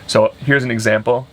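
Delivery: usual speaking voice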